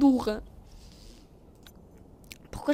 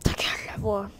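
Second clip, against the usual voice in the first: muttered put-down; 5 semitones lower, 4 dB quieter than usual. Real, not acted. Snapping at game announcer voice.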